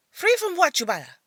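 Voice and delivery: high, loud irritated retort; mother irritated with her daughter. Acted. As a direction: snap the question back at her.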